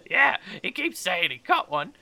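high, loud character voice in a put-on accent; scoffing tavern woman. Companion mocking the catfish story, high and scoffing. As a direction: pick the boast apart, item by item.

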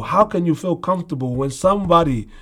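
usual speaking voice

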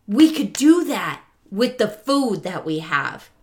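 put-on eager kids voice. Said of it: "And they're like".

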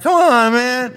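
put-on very high, loud drunken whine; drunk insistence. Drunk customer answering a doubtful bartender, high loud whining insistence. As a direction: protest like a drunk being doubted. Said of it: "bartender's like, "Are you sure?".

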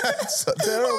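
high, breathless laugh through the words. Real, not acted. Still laughing at the kid's name, wheezing.

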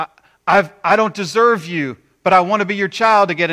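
loud, high, stumbling plea; earnest plea. Acted. Sinner turning back to God, pleading loudly. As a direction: plead urgently, tripping over the first words.

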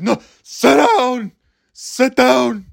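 high, drawn-out New York teacher bark; Ken Jacobs impression. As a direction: order students back down, slowly and crossly. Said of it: "that's what Ken Jacobs sounded like".

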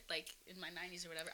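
usual speaking voice

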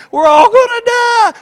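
shrill, very high, loud mock panic; panicked cry. Passenger on a failing plane crying out in panic, very high and loud. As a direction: wail it like a terrified passenger.

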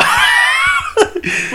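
very high, drawn-out laughing squeal; cracking up while asking; laughing question. Real, not acted. Turning question on friend, cracking up.

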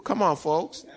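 normal talking voice

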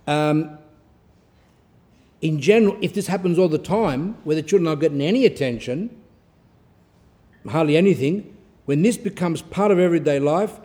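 normal talking voice

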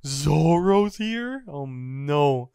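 loud, drawn-out mock alarm; panicked. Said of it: "Kaku's shitting his pants".

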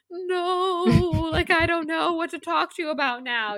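high sing-song whine of parents; mock parent whine. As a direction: parents whining at their grown kid, mock lament. Parents voiced in a story.

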